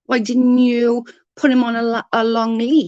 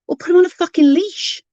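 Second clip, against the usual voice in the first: exasperated outburst; 8 semitones higher, 3 dB louder than usual. Real, not acted. Angry rant about neighbour's dog.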